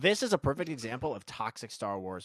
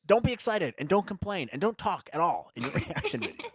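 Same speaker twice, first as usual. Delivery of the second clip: higher and louder, fast, hammed-up scolding; mock scolding rant; 8 semitones higher, 8 dB louder than usual. Host mocking a commenter who scolds Melissa, loud hammed-up scolding. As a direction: rattle off the rules like a bossy critic.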